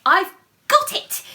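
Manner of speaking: high, loud burst of discovery; excited mouse. Acted. The little mouse thrilled by his idea, squeaking loudly. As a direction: shout the idea like a eureka. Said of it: "he said".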